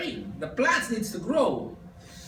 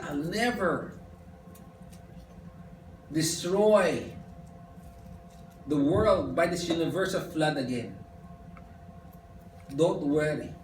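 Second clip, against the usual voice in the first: low, soft and unhurried, a comforting promise; gentle reassurance; 7 semitones lower, 6 dB quieter than usual. Retold or imitated. God softly reassuring a fearful Noah, low and gentle. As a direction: ease his fear quietly, like a father.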